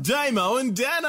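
high, loud mock-announcer cry of the names; mate repeats the names, loud and gleeful; amused outburst. Real, not acted. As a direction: call the names out like a big reveal. Reacting to the similar names.

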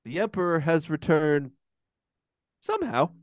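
pause, then a high, incredulous "somehow"; mock opening crawl. Fan quotes the hated opening scroll, mockingly. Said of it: "the beginning of the movie wasn't".

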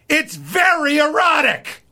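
high, slow, shouted "It's very erotic"; yelled. Retold or imitated. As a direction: bellow it out like the shocked therapist. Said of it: "He just yelled".

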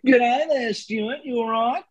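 high, bright Australian George Lazenby voice; Australian Lazenby voice greeting his mate Stuart; Australian accent. Retold or imitated. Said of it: "George Lazenby here".

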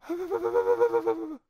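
high, quavering mock whimper; worked-up wife. Wife worked up minutes into the movie, mock whimper. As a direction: imitate a worked-up wife's wail. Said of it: "so she's already worked up".

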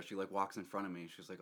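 normal talking voice

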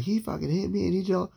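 retold screaming accusation. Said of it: "starts screaming at them".

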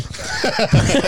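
breathy high-pitched laugh; a squeaky burst of laughter. Real, not acted. Laughing at a remark about her.

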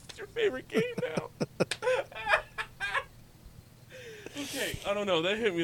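high giggly voice; laughing. Real, not acted. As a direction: still laughing. Laughter subsiding.

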